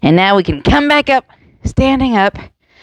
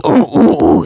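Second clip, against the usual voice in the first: low-ish playful ooh ooh hoots; gorilla hoots; 3 semitones lower than usual. Shown as a demonstration. Teacher showing kids a gorilla sound, playful hoots. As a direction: hoot like a friendly gorilla. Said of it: "Sounds more like a gorilla".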